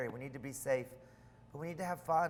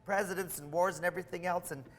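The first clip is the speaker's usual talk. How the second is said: excited; 5 semitones higher, 5 dB louder than usual. Retold or imitated. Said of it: "I says".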